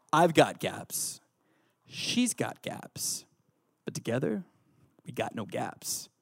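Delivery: hushed and drawn out, sinking at the end; preacher retrying Rocky's line, slow and hushed. Retold or imitated. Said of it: "I'm gonna attempt to do the voices".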